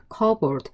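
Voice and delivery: "커버드" with a silent P; correct cupboard pronunciation. Shown as a demonstration. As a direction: say "커버드" like CUB-erd, no P sound. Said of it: "다시 한번 발음". (P 소리 없이 "커버드"로 발음)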